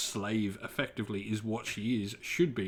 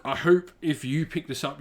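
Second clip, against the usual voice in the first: usual speaking voice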